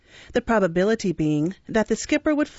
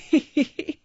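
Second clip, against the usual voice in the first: sly chuckle; 7 semitones higher than usual. A narrator's reading. Undertaker chuckles in story.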